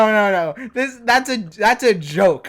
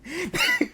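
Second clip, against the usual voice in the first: high shrieking laugh; screaming laugh; 11 semitones higher, 6 dB quieter than usual. Real, not acted. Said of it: "Stop screaming, [name]".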